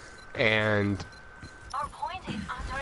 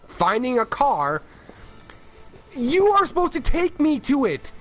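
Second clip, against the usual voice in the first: angry rant; 8 semitones higher than usual. Retold or imitated. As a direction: vent like you're fed up. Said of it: "I'm like".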